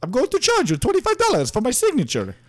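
high cartoon Italian sales pitch; Mario. Voice actor charging fans for signatures, high Italian character voice. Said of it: "travels to conventions and makes money".

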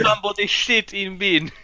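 imagined cleaner. Acted. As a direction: play the baffled cleaner, voice lifted. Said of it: "imagine the cleaner".

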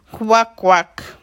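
loud croaky kvak kvak; laughing frog croak. A narrator's reading. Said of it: "nevetett a béka".

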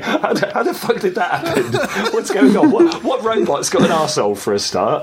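laughing disbelief; shocked, half laughing outburst; shocked player. Pretend. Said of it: "They're like".

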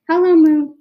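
calling up loud to the moon; shouting. Acted. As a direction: shout it up into the sky. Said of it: "he shouted".

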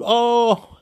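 high, loud "oh"; mock scream. Shown as a demonstration. Fan thrilled by the game, mock scream. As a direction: let out a brief high cry of joy. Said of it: "I could scream, shout".

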